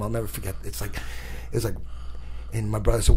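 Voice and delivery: ordinary talk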